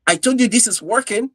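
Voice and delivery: high, giddy look look look; bursting to show the good news; excited. Retold or imitated. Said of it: "I was excited".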